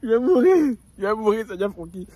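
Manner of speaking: loud laughing wail; real helpless laughter; crying with laughter. Real, not acted. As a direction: laugh till it hurts. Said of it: "il peut plus".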